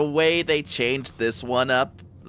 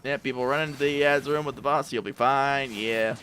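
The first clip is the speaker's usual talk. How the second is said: normal talking voice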